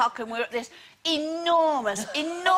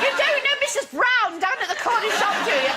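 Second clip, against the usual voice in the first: high, fast gabble of recognition; excited gushing; 7 semitones higher, 3 dB louder, faster than usual. Retold or imitated. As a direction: gush quickly, imitating an excited fellow local. Gushing to a fellow local abroad.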